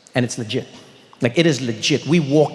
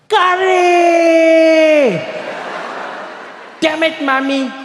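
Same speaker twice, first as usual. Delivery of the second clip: put-on high, loud childish scream of disgust; a little boy yelling at his lunchbox, in a high scream; kid screaming; 13 semitones higher, 5 dB louder, much slower than usual. Little Thiessen screaming at his packed lunch, high and loud. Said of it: "scream at the top of his lungs".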